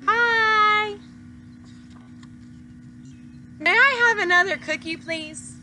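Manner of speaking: the pigeon copying the duckling's manners; sweet polite pigeon. Acted. Pigeon mimics duckling's polite ask.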